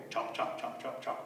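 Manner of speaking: low, soft rhythmic chomps; quiet repeated chomp; chomping sounds. A narrator's reading. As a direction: gnaw along in steady muttered beats. Imitated chewing on wood.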